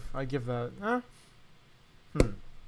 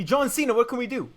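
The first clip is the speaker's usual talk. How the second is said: higher, louder mock exclamation; mock excited exclaim; 7 semitones higher, 5 dB louder than usual. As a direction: ham up the fake movie line. Mocking a hypothetical movie line.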